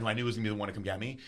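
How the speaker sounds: usual speaking voice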